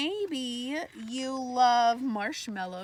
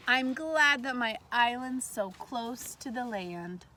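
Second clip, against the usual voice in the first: slow, louder storybook line; story reading; 4 dB louder, slower than usual. Acted. Teacher reading the book's last line to students, slow read-aloud. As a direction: read the happy ending line to the kids.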